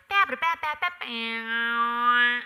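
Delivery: loud, high punched syllables with a long final note; sung-out entrance rhythm. Shown as a demonstration. Actress showing her own entrance to director, loud scatted rhythm. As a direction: belt out a scat-like drum riff. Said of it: "walk in and go like".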